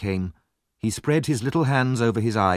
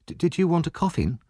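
innocent; 8 semitones higher than usual. A narrator's reading. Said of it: "asked Oliver innocently".

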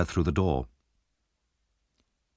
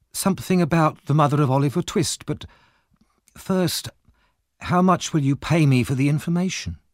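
higher-voiced shrewd wife bargaining; Mrs Bumble; 7 semitones higher than usual. A narrator's reading. Wife bargains with Monks for money, higher-pitched shrewd voice. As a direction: push for payment in a higher wife's voice.

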